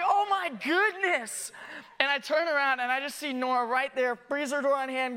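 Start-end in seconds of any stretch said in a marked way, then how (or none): 0.0-1.5 slow, higher, louder mock horror; mock alarm. Dad retelling his panic when the freezer door slammed, slow mock horror. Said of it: "I was like".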